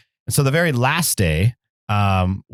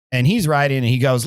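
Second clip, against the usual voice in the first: usual speaking voice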